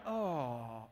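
a low, soft, grumbling oh; low muttered oh. Retold or imitated. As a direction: groan it under your breath like a whole plane. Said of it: "everyone went".

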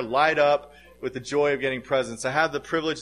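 normal talking voice

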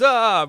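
high, comic "ah" of panic; hammed-up yelp; mock fleeing scream. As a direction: imitate a boss running away screaming. Boss screams and runs away.

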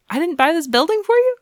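high, fast, giddy gushing; eager high mock voice. Danny eagerly offers to buy the nurse's building, high giddy voice. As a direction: gush at her like an overeager rich boy.

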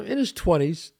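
ordinary talk, nothing marked

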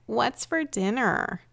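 mock whimper, stretching out the plea. Imagined hungry family member asking the parent about dinner, whimpering. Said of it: "you hear a whimpering voice say".

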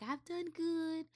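high, soft, smug imitation of a cancer-walk supporter; mock do-gooder voice. Vlogger mocking charity walkers in a high, soft voice. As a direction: mimic the self-satisfied feel-good crowd.

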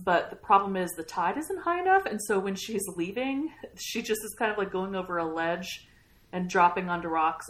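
ordinary talk

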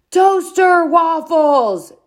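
slow, high yelled answer. A narrator's reading. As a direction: yell it across the room. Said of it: "Dad yelled louder".

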